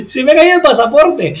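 high, exclaimed mock dismay; sorpresa actuada. Repeats acted discovery of dropped passport. (exclamación aguda de sorpresa actuada)

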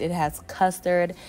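ordinary talk

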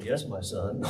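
very low, soft mock-holy calm; preacher jokingly plays Jesus to a scared man. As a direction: answer like a serene Jesus, deadpan, chuckling. Joke reply as Jesus.

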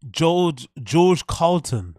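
slow, loud "George Carlton" for Carlin; mock the slip, Carlton with an added t; mispronounced name. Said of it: "pronounce their name".

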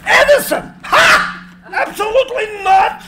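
high, loud scoffing shout with a laugh; mocking outrage at Edison's name; scornful refusal. Tesla refuses the Edison medal.

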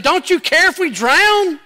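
loud, high-pitched alarmed plea; acted-out cry of panic to a sleeping master; panicked disciples. Fishermen waking Jesus as the storm hits, panicked.

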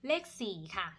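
ordinary talk

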